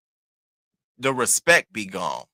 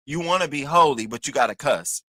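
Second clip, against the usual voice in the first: usual speaking voice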